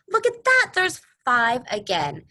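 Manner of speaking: excited. Acted. Teacher marvels at pattern.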